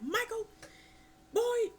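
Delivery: high mock scolding dad; hammed-up angry father; mock Joseph scolding. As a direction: call out like a fed-up father. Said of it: "You're like".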